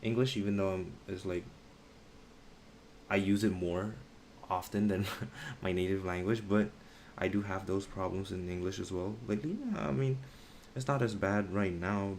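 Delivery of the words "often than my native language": ordinary delivery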